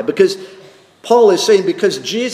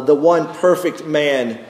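ordinary delivery

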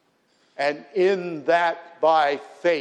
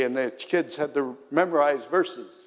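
normal talking voice

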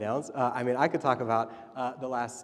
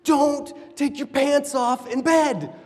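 much higher, louder, slow angry dad; dad scolding his daughter at night, loud and high; exasperated scolding; 11 semitones higher, 11 dB louder, slower than usual. Retold or imitated. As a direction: scold the toddler, holding back a yell. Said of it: "And I'm super angry".

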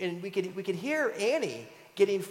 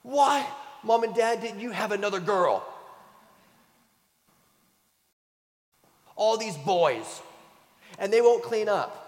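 higher, louder mock complaint of a fed-up daughter; exasperated little girl; 6 semitones higher, 6 dB louder than usual. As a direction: whine it, hands on hips. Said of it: "she goes".

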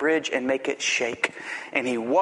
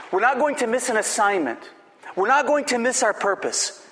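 higher, driving sermon cadence; rising preaching; 6 semitones higher than usual. Acted. Preacher to congregation, building a higher, insistent refrain. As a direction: hammer each "not" like a pulpit refrain.

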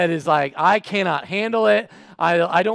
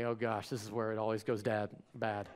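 low, hushed mumble to himself; dreading aside; 9 semitones lower, 14 dB quieter than usual. Retold or imitated. Customer fearing it always goes bad, dreading under his breath. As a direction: sink into quiet, acted-out dread.

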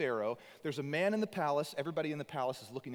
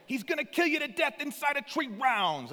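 much higher, louder gritty Boston bark; gritty Boston trainer; 12 semitones higher, 6 dB louder than usual. Retold or imitated. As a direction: snap it like an old Boston boxing coach. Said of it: "kinda gritty, like, Boston guy".

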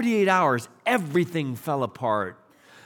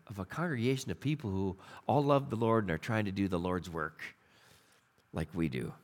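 hushed aside; 9 semitones lower, 8 dB quieter than usual. Real, not acted. Sermon trailing off.

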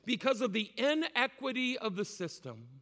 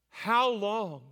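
"How long?" called out louder, falling at the end; louder cry; 4 dB louder than usual. Shown as a demonstration. Preacher echoing the congregation's cry, louder. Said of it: "say it with me".

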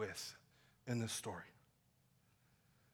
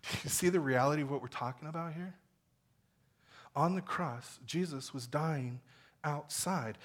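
normal talking voice

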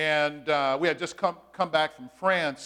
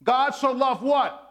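slow, stressed question inviting the answer; call-and-response question; 7 semitones higher, 6 dB louder, slower than usual. Acted. Pastor prompting the congregation to answer, slow and stressed. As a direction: pull the answer out of the room.

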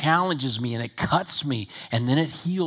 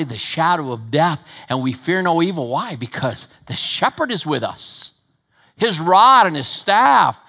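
louder, higher preaching voice; fervent preaching; 6 semitones higher, 8 dB louder than usual. Acted. Pastor urging the church to trust God, fervent and loud. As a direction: preach it with rising fire.

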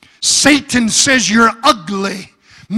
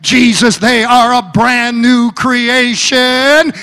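higher, louder, crying preacher's voice; preaching at full force; 4 semitones higher, 5 dB louder than usual. Acted. Preacher speaking God's promise to the church, full-force preaching. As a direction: proclaim it over the congregation. Sermon climax, hallelujah.

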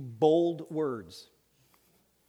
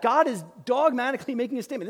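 normal talking voice

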